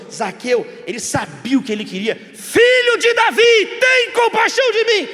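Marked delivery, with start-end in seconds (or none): 2.2-5.1 high, loud, slow pleading shout; desperate cry. Acted. Blind beggar crying out to Jesus, loud and desperate. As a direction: cry out to be heard over the crowd.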